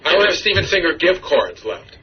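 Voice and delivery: usual speaking voice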